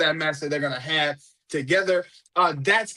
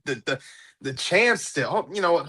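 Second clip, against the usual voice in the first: ordinary talk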